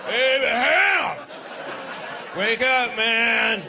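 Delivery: mock God shout. God shouting to wake Abraham.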